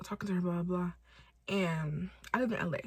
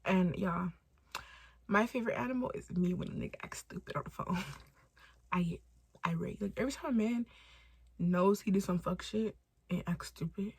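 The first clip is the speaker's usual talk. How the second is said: normal talking voice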